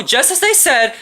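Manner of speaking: usual speaking voice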